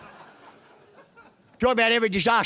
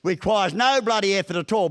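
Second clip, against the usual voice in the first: normal talking voice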